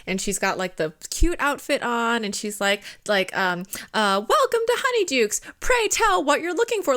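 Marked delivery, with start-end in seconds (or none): 4.3-7.0 high, theatrical welcome, imitating the clerk; in-character shop greeter. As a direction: greet like a costumed sweetshop keeper, pitched way up. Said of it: "she opened correct".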